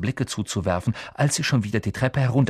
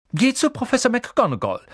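put-on much higher, pressing girl's voice; urging Hermine; 13 semitones higher, 4 dB louder than usual. A narrator's reading. Hermine urges Neville to go to the teacher, high and insistent. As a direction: push him to go, insistent. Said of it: "drängte ihn Hermine".

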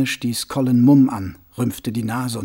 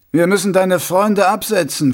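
a little louder, higher; slightly raised voice; 6 semitones higher, 5 dB louder than usual. A narrator's reading. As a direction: raise the voice a bit for others to hear. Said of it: "hob die Stimme ein wenig".

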